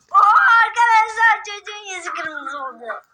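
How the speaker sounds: high, loud excited shout. Real, not acted. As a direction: shout it out amazed. Friend's face red from pepper.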